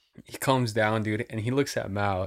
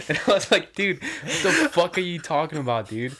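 high-pitched, laughing through the words; real laughter breaking into speech; 7 semitones higher than usual. Real, not acted. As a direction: crack up while telling the story. Cracking up while retelling his quote.

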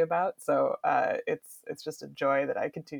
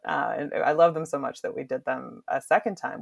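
ordinary talk

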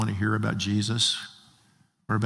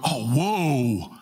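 long drawn-out, amazed whoa; mock amazement, stretched out; awed whoa. Shown as a demonstration. Preacher showing the wrong meaning of woe, awestruck. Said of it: "you see something that's really awesome".

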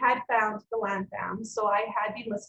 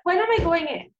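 louder, higher, fed-up demand; retold yell; 5 semitones higher, 4 dB louder than usual. Hungry patient yelling at a young nurse about her surgery. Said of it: "I kind of yelled at one of these young nurses".

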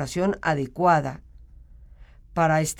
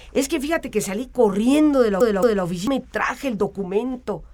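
excuse-making voice; 5 semitones higher than usual. Retold or imitated. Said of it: "van a justificar su impuntualidad".

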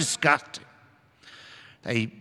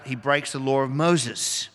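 ordinary delivery

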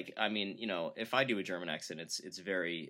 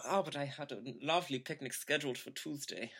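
put-on higher, softer German-accented lament; dainty German accent; 4 semitones higher, 5 dB quieter than usual. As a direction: fret daintily over a missed picnic, German-accented. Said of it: "like, have picnics".

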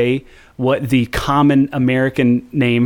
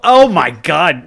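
amused groan; 4 semitones higher, 5 dB louder, slower than usual. Real, not acted. Exasperated after cohost's slip.

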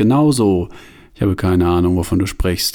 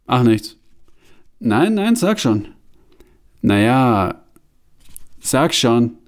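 ordinary delivery

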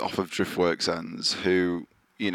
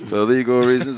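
louder, mock-official disclaimer voice; mock legal disclaimer; 3 semitones higher, 5 dB louder than usual. Shown as a demonstration. Host jokes to cohosts in a mock-official disclaimer voice. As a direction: read it like a lawyer's formal warning. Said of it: "this is a joke".

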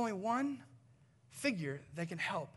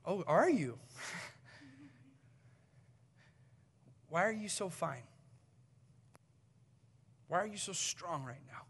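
gentle laughing tease. Retold or imitated. Pastor gently teasing with laughter.

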